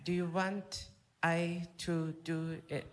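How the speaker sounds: quiet, slow offer in a put-on voice; nurse offering. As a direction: nurse offering to take over. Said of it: "then she says".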